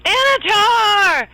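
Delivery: high, drawn-out scream; screamed name. Acted. Calamar spots Anatar across the way, screaming. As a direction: cry the name out at full voice. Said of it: "He turns around to Anatar and screams".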